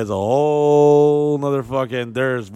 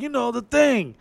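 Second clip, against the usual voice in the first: much higher, tipsy coaxing; drunk club owner; 12 semitones higher than usual. Retold or imitated. Drunk owner egging the comic on, pitched way up. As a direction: egg him on, pitched way up. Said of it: "he said".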